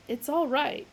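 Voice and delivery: irritated reassurance; angry explaining. A narrator's reading. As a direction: snap it. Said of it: "explained angrily".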